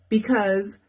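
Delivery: ordinary delivery, nothing marked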